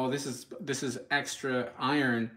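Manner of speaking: ordinary talk, nothing marked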